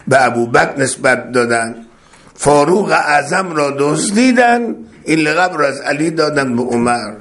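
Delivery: ordinary delivery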